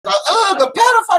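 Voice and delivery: high-pitched hollered accusation; mock heckler yell. Comic replays the heckler's yell from the crowd, high holler. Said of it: "somebody yelled out".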